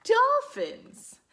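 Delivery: high, loud happy announcement; name it with delight; excited. Real, not acted. Excited naming of dolphins.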